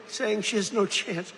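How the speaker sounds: usual speaking voice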